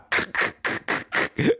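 high breathy hooting laugh; DJ's laugh. Retold or imitated. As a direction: hoot like a show-off. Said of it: "He had one of those laughs".

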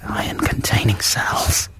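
slow under-the-breath whisper. Real, not acted. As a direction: murmur it back to yourself. Repeating producer's prompt under breath.

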